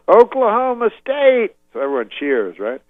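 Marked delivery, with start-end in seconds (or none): 0.1-1.5 high, loud, slow stadium call; rally the crowd; Cosby hyping a stadium crowd, shouting; crowd-rousing shout. Retold or imitated. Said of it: "He goes".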